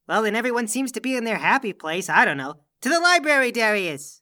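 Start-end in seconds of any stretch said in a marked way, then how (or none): 2.8-4.2 raised, higher-pitched rallying cry; commanding call. Acted. Honored guest orders the servant to the library, commanding call. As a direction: call out a grand marching order.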